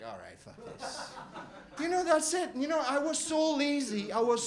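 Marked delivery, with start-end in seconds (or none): 0.1-1.1 much lower, softer, slow don't-care mumble; bored teacher. Retold or imitated. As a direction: shrug it off like a teacher who couldn't care less. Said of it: "They're just like".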